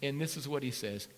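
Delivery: ordinary talk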